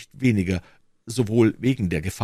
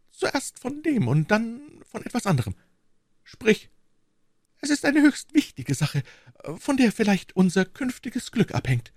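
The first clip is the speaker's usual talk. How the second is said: high, pressing tone; wife insists on an important matter; urgent wife. A narrator's reading.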